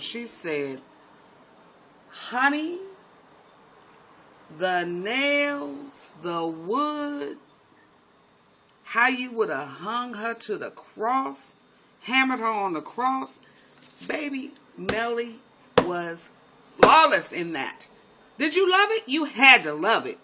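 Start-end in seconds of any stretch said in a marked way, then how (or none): 2.1-13.4 put-on high, slow Southern drunk drawl; tipsy Southern belle, dragging it out. Melly drunk and spiteful to Fitz, high Southern drawl. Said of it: "She's very Southern when she's drunk". 14.1-20.2 loud, high rave; excited gushing. Real, not acted. Vlogger gushing to viewers, loud and excited. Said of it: "gushing to viewers".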